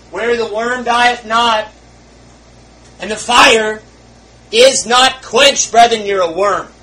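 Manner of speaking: shouted hellfire warning, slow and high; roaring preaching. Real, not acted. Preacher roaring at the congregation about hell. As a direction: thunder it out from the pulpit.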